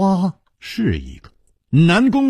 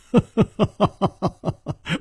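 high, rapid run of ha-ha laughter; hearty laugh; 6 semitones higher than usual. A narrator's reading. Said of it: "楚留香大笑". (爽朗大笑)

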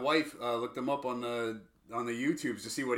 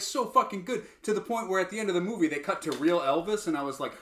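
ordinary delivery

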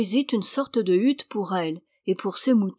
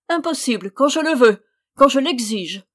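high, loud insistence; imperious outrage; 5 semitones higher, 7 dB louder than usual. A narrator's reading. Witch insisting on the marriage, imperious and angry. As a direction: hammer out the demand, outraged.

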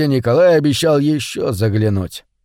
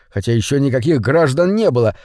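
ordinary delivery, nothing marked

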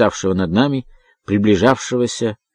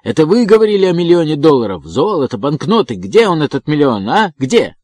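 heated, raised-voice accusation; angry shouting; 5 semitones higher, 4 dB louder than usual. A narrator's reading. Evans demanding the promised million dollars, shouting angrily. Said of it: "со злобой закричал он".

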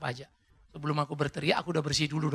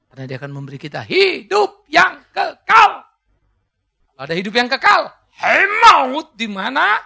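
loud, high preaching, shouted to the church; fiery preaching; 6 semitones higher, 14 dB louder than usual. Acted. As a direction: preach it at full force, voice cracking. Preaching at full force. (khotbah berapi-api, suara tinggi dan keras)

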